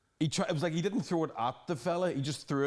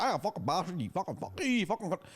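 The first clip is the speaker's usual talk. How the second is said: mock angry local; 5 semitones higher than usual. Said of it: "angry North Coast people".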